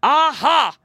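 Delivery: gloating bear exclamation; bear's cry. A narrator's reading. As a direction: pounce on it like a storybook bear. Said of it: "the bear cried".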